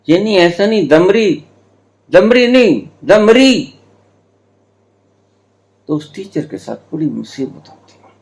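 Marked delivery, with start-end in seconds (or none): 0.1-3.7 high, insistent correction of दमड़ी; exasperated teacher correcting. Retold or imitated. Teacher frustrated that the children repeat his mistake, higher and insistent. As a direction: correct the children again and again, higher each time. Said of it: "बड़ी मुश्किल हो जाती है".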